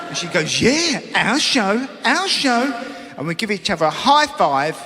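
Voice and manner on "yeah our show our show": wife's sing-song echo. Retold or imitated. Wife echoing husband.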